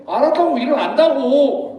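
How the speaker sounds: acted sulky kid insisting; fearless back-talk. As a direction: snap back at mom, stretching the ending. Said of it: "그거에 대한 무서움 하나도 없이".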